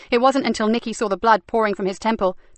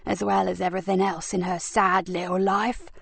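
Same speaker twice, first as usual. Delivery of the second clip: sneering villainess; 4 dB quieter than usual. Acted. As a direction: savour the cruelty like a treat. Frankie continues.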